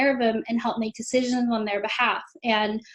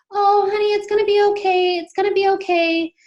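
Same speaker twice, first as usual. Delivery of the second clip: high, loud, placating reassurance; cooing nurses; 8 semitones higher, 5 dB louder than usual. Retold or imitated. Nurses reassuring an upset mother, high-pitched cooing. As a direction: imitate over-soothing nurses in a raised pitch.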